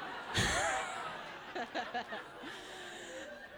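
long high giggle; high embarrassed laugh. Real, not acted. Embarrassed after saying name.

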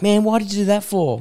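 mildly hurt, meek man; gentle pushover voice. Retold or imitated. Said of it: "he'd be like".

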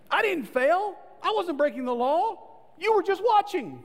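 high, loud protest of innocence; defensive ego voice. Retold or imitated. The ego blames the officer in a high, loud, indignant protest. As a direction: act out the wounded ego. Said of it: "That's our ego".

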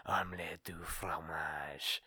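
low, slow whisper in her ear; seductive whisper. Retold or imitated. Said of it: "right next to the ear".

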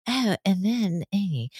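mumbled, dragging, lifeless imitation; mock a dull host trailing off; bored podcaster. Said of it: "they're so boring".

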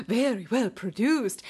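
slow, imitated polished announcer; commercial voiceover. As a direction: draw it out like a slick ad. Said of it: "one commercial that was like that".

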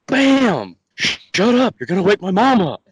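put-on high, loud drunken shouting; retold yell. Drunk Danny yelling at Larry to shut up, loud and high. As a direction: bark it like a drunk buddy losing it.

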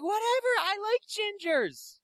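very high, slow mock woman's voice; dismissive female fan. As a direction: imitate her, high and drawn out.

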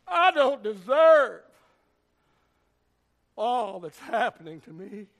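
sulking prophet whining under the juniper tree, slowly; self-pitying whine. Retold or imitated. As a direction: mope like a sulking child. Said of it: "feeling sorry for himself".

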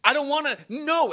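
high-pitched, protesting wail; hammed-up weepy refusal; tearful pleading. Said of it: "he wept like a bird".

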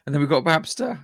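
normal talking voice

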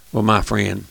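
normal talking voice

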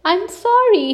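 high, upset little bear; upset apology. A narrator's reading. Little Bear to his angry brother, upset. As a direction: apologise in a small high-pitched plea. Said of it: "His lip began to tremble".

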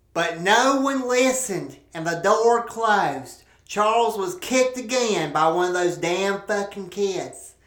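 ordinary talk

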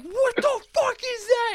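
high, slow disbelieving cry; startled what the fuck; shocked disbelief. Retold or imitated. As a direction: retell the shock of seeing a flamethrower. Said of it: "I'm like".

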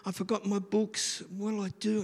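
put-on a light high child's worry; worried boy. Boy frets to his teacher about his books, lightly high. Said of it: "you know, that sort of thing".

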